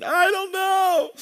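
put-on high, slow little-boy mumble; a child's high, drawn-out pout; imitating sulky child. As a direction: play the tearful son answering dad. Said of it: "you can hear him crying".

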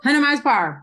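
amused emphatic repeat; gleeful echo. Real, not acted. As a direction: toss the phrase back with glee. Gleefully echoing the phrase.

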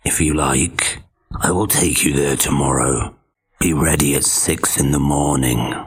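low, falsely friendly wolf; sly wolf sounding nice. Acted. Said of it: "trying to make his voice sound friendly".

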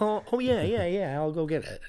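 high, laughing ditzy waitress; ditzy "oh yeah yeah"; airhead waitress. Retold or imitated.

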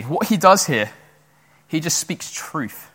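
normal talking voice

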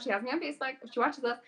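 ordinary delivery

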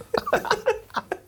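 very high helpless laugh. Real, not acted. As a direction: break into squealing laughter.

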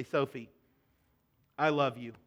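low, soft and loving, with a pause; tender reassurance. Retold or imitated. Father walks in to his crying daughter, tender and soft. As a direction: gently reassure your child.

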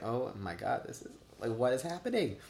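soft, partly whispered disbelief; hushed overwhelmed reaction; bewildered hush. Retold or imitated. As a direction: murmur to yourself in confusion.

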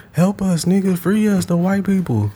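high, begging voice of a dog stuck indoors; pleading trapped dog. Retold or imitated. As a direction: beg like a dog behind the window. Dogs in window voiced pleading.

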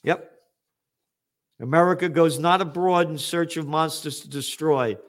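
ordinary talk

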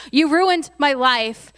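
loud, slow, bitter "you ruined my life"; hammed-up wounded accusation; angry accusation. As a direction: throw the blame at the brothers, hard and slow. Joseph voiced, mock reproach.

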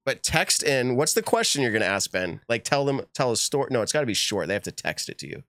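usual speaking voice

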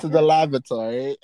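S0 mock-proper repeat with rising end; prim mock posh word; mock British. S0 riffing on British phrasing with a mock-proper lilt.

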